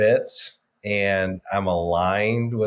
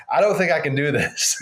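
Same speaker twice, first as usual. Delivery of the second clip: higher, louder anxious doubt in bed; higher, louder half-mock worry at night; doubtful worry; 7 semitones higher, 4 dB louder than usual. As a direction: let the doubt creep in, a bit higher and louder. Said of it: "you lay in bed going".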